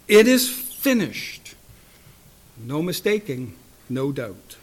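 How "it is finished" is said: slow, loud, high cry. Acted. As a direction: cry it out slowly and firmly. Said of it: "with a loud voice, he cried out".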